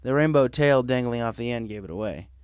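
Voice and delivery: ordinary delivery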